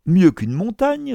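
high, loud topping reply; excited retort. A narrator's reading. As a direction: top the remark with fervour. Professor triumphantly tops the mountain remark. (réplique exaltée)

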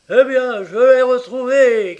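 high, loud triumphant shout; triumphant cry. A narrator's reading. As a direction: cry out the big news. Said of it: "cria-t-il".

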